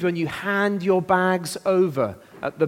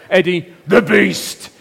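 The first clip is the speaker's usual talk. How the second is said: louder, slow, drawn-out "Eddie the Beast"; ring announcer; 7 dB louder, slower than usual. Acted. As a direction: announce him like a wrestling champion. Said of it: "Can we say that?".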